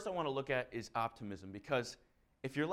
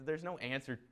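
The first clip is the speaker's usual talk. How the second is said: ordinary delivery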